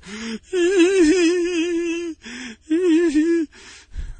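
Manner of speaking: high, loud, exaggerated drunken wail; long hammed-up bawling; imitated blubbering sob; mock crying. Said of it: "He starts crying and shit".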